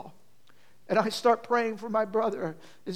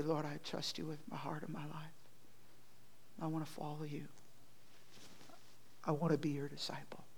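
very soft, low prayer; hushed prayer; 5 semitones lower, 14 dB quieter than usual. Shown as a demonstration. Preacher modelling a surrender prayer to the Lord, hushed and low. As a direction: pray it quietly, sinking to a near whisper at the end.

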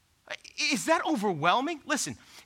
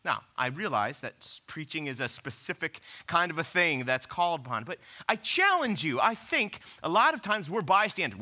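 usual speaking voice

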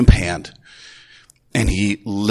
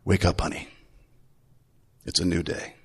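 gentle wake-up; 5 semitones lower, 9 dB quieter than usual. Retold or imitated. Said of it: "And I just said".

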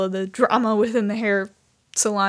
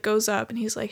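ordinary delivery

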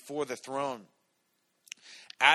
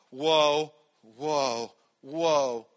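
excited whoas; 2 semitones higher, 8 dB louder, much slower than usual. Retold or imitated. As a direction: mimic a thrilled kid on a fast ride. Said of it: "There was three whoas".

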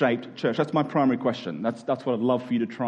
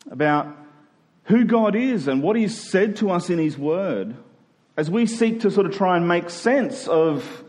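raised, earnest sermon voice, slow on key words; preaching; 4 semitones higher, slower than usual. Acted. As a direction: press the point to the congregation. Preaching with rising force.

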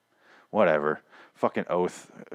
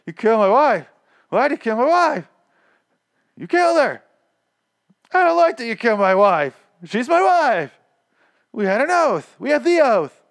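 much higher, loud hammed-up grief; bad acting; 13 semitones higher, 6 dB louder than usual. Mocking the poor actor as Paul confronts the doctor, hammed-up shouting. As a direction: overact the wronged husband badly. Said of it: "he's not a good actor".

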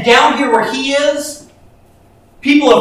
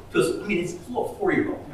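usual speaking voice

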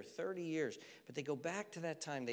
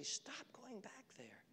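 gentle whisper; 9 semitones higher, 12 dB quieter, slower than usual. Acted. God voiced tenderly, whispered.

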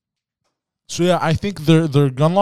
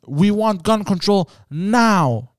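mock protesters; 7 semitones higher, much slower than usual. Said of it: "people online".

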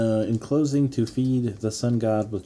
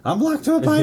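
high panicked quote; 6 dB louder than usual. Retold or imitated. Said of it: "he's like".

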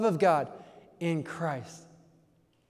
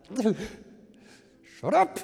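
much higher, louder fed-up outburst; exasperated snap; 4 semitones higher, 3 dB louder than usual. Retold or imitated. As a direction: blurt it out at the end of your rope. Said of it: "And I'm like".

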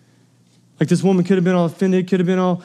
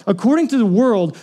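ordinary talk, nothing marked

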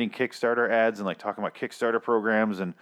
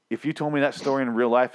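ordinary talk

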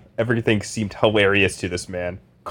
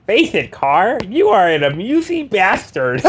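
high, goofy loser voice; a higher nerdy leading-man voice; Carr voice; 10 semitones higher, slower than usual. Acted. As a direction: play it as a hapless self-admiring loser. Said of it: "this is my car review".

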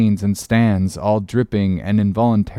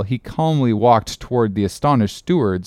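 ordinary delivery

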